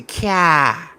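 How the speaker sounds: long Boston "cah" for car; imitated Boston vowel, no R; Boston accent. Shown as a demonstration. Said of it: "they say".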